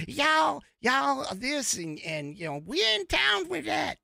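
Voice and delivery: mock the politician's put-on Southern accent; bad Southern impression. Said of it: "That was a bad impression".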